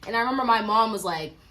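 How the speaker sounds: ordinary delivery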